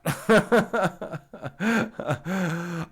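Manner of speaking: a real laugh at his own joke; a short amused laugh. Real, not acted. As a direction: let a laugh roll out.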